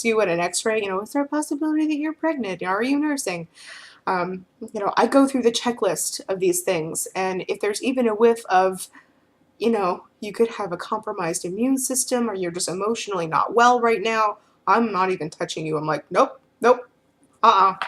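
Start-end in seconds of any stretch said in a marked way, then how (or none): 1.0-3.5 high, quick clinical nurse voice; imitating a nurse. X-ray nurse quizzing a patient, high and brisk. 16.1-17.8 firm refusal. Retold or imitated. Feeder refuses to touch an unwell client, loud and firm.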